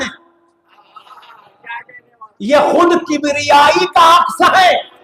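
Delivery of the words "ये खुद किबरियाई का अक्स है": slow, raised, ringing declamation; exalted preaching. Real, not acted. Preacher extolling Murtaza to the congregation, slow exalted oratory. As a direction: stretch the words to a rousing climax.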